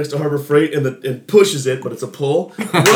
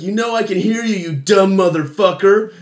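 higher-pitched mock outrage; angry retort; 5 semitones higher than usual. Driver confronting the man who insulted her, angry. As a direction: imitate an indignant driver snapping back.

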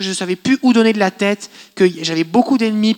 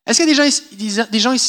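ordinary talk, nothing marked

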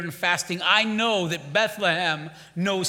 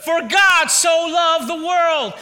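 preaching shout; 9 semitones higher, 8 dB louder, slower than usual. Real, not acted. Preaching peak.

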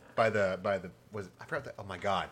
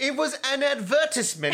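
mock correcting; 13 semitones higher, 6 dB louder than usual. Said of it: "but trailers are advertisements".

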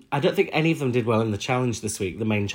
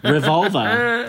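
laughing drawl; 7 semitones higher, 4 dB louder, much slower than usual. Real, not acted. Drawn-out laughing plug.

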